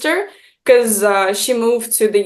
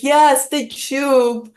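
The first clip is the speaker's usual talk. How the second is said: slow, drawn-out retold retort; emphatic confirmation; 3 semitones higher, much slower than usual. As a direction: confirm it slowly, stretching each word. Her retort to his correction.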